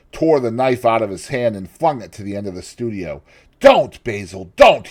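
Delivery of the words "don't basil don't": loud, rising cry on the last don't; desperate cry. A narrator's reading. Dorian crying out to stop the painter. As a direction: shout to stop him. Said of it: "he cried".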